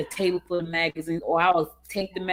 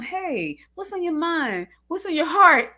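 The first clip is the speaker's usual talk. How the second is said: higher, put-on sweet and caring voice; mock sweet wife; 8 semitones higher than usual. Wife sweetly asks her husband what's on his mind, put-on sweetness. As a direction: coo it like an overly sweet wife. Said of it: "So if I said to him".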